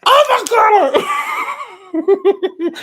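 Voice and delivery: very high, loud shriek of disbelief; shocked delighted scream. Real, not acted. Host seeing her friend's name drawn, real excited shriek.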